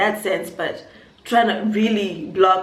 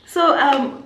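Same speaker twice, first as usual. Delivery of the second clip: usual speaking voice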